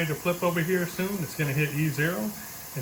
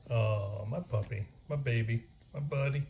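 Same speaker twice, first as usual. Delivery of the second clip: low, soft doting coo; talking to a dog; 4 semitones lower, 4 dB quieter than usual. Real, not acted. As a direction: murmur fondly to a pet. Cooing to his puppy.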